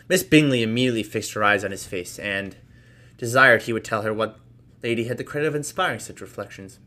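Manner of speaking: plain reading voice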